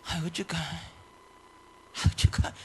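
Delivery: soft, helpless mock lament; hushed, defeated fretting; dejected. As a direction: play a pastor losing heart. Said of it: "왜 같이 기죽어가지고".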